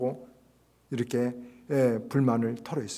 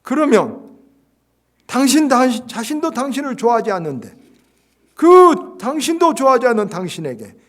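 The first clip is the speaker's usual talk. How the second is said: loud, much higher hammering rebuke; preached rebuke; 9 semitones higher, 11 dB louder than usual. Acted. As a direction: thunder it at him from the pulpit. Jung rebukes the pastor, preached.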